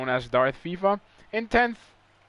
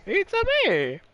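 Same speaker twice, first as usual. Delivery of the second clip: much higher, squeaky cartoon plumber voice; Mario impression; 5 semitones higher, 4 dB louder, slower than usual. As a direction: mimic the cartoon plumber in a falsetto. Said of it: "your best Italian impression".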